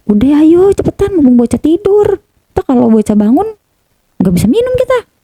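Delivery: high-pitched reenactment of hurrying someone; retold urging. Urging partner to drink quickly.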